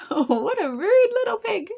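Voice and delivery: indignant. Acted. Wolf indignant at pig.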